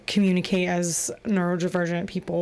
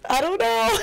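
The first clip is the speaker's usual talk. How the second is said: silly high voice; 8 semitones higher than usual. Pretend.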